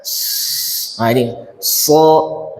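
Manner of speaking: a voiceless "s-" hiss, then a slow "so"; hissing letter. Shown as a demonstration. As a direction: push air out on "s-", then "so". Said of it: "Ini adalah huruf hams".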